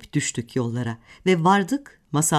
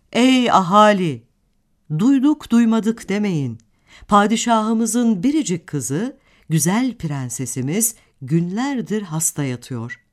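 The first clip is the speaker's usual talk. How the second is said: town crier; 3 semitones higher, 4 dB louder than usual. Acted. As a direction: call out to the whole town. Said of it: "diyormuş gümbür gümbür sesiyle".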